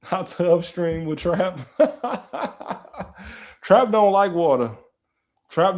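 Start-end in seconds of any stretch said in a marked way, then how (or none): 1.6-3.0 high giggle. Real, not acted. Laughing at dog.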